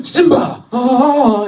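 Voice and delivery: high, loud squeal of delight, hammed up; mock tourist excitement. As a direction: cry out like thrilled tourists. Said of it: "we all stood up and went".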